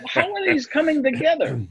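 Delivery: baffled question. Retold or imitated. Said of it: "I'm like".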